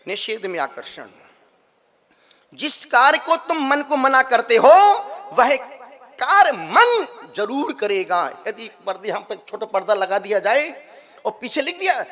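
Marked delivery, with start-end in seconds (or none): 2.5-8.4 loud, raised, stretched-out emphasis; emphatic preaching. Acted. Preacher on the lure of the forbidden, forceful and loud. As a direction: hammer the moral home.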